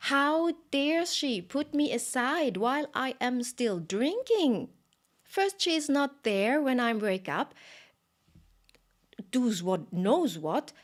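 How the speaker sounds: put-on high, loud indignant child's tantrum; angry toddler Krishna. A narrator's reading. Baby Krishna complaining his mother put him aside, high loud tantrum. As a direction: sputter like a baby denied his milk. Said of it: "And he became so angry".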